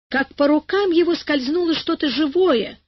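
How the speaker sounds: ordinary delivery, nothing marked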